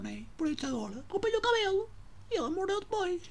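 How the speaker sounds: put-on very high, squeaky old granny; old lady voice. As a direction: play a fussy old grandma going on and on.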